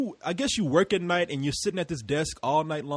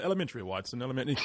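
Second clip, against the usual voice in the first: lower, softer, lightly posed Holmes line; a light mock Holmes, softer and lower; Sherlock Holmes impression; 5 semitones lower, 8 dB quieter, much faster than usual. Imitating Sherlock Holmes to the podcast hosts, lightly hammed. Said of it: "He's always".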